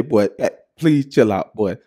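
normal talking voice

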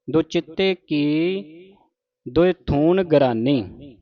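ਚਿੱਤੇ with a doubled, held "tt", spoken slowly; slow careful recitation. Shown as a demonstration. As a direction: spell out ਦੋ ਚਿੱਤੇ slowly, leaning on the addak. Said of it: "ਅਦਕ ਲਾ ਕੇ ਉਚਾਰਨ ਕਰਨਾ ਹੈ". (ਹੌਲੀ ਹੌਲੀ, ਅਦਕ ਨਾਲ ਭਾਰਾ ਉਚਾਰਨ)

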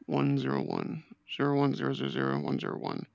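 low flat robotic binary; robot saying binary. Pretend. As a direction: recite digits like a robot.

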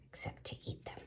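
put-on hushed whisper to the children. A narrator's reading. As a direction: whisper it like a secret. Reader's whispered aside.

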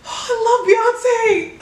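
high, near-tearful gush; overwhelmed cry. Real, not acted. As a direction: gush like you might cry. Overwhelmed by the song.

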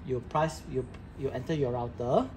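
ordinary talk

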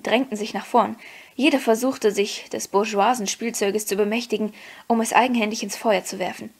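ordinary delivery, nothing marked

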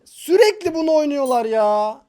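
loud, high frustrated whine; exasperated rant. Real, not acted. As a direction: vent about the hated deck. Venting hatred of a deck.

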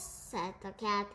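ordinary delivery

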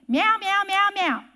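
insistent imitated cat meows; meowing cat. Hungry cat pestering owner at feet in bedroom, imitated meowing. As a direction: meow over and over like a pestering cat. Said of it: "she would meow after you".

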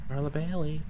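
low, hushed call to a ghost; creepy calling. Pretend. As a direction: softly summon the ghost, keeping it low. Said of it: "Maybe I'm being creepy".

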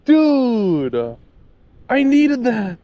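loud exasperated yell; frustrated shout. Real, not acted. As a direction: cry out at the lost fish. Lost fish frustration.

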